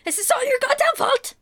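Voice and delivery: high, mock-outraged squawk; Irish lad's accusation. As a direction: accuse her in comic fury. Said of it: "The Irish lad's like".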